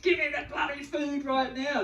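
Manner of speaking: high, loud, snapping demand for food; angry demand. Retold or imitated. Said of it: "How we feel is".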